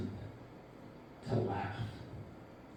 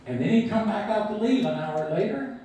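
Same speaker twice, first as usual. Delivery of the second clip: normal talking voice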